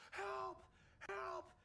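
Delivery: high, loud desperate yelling for help; panicked cry for help. Retold or imitated. Recruit in the pool yelling for help, panicked. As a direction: scream for help like you're going under.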